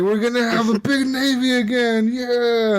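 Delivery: high-pitched gleeful caricature; hammed-up patriotic cheer; mock British navy enthusiast. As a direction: pitch it up as an eager Brit. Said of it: "They're like".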